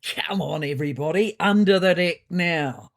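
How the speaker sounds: whooping call to the group, growing in force; whooped. A narrator's reading. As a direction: round everybody up, stretching out the last words. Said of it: "whooped Ron".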